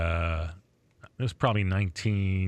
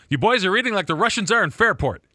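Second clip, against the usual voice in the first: imitating mom; 11 semitones higher, 7 dB louder than usual. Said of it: "one of my favorite lines".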